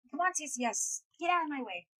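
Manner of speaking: loud, high-pitched impatient urging. Real, not acted. Player telling someone to get out of the way, impatient. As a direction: raise the voice to hurry someone along. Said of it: "I'm impatient".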